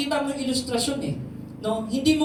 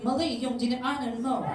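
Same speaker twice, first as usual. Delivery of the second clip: softer, mock navigation voice; lightly voiced GPS accent, amused; 6 dB quieter than usual. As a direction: imitate a car GPS scolding you. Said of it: "may accent pa".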